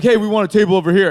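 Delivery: loud, quick dude calling for a table; exaggerated take-charge bro; mock dude voice. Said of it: "tries to be the dude".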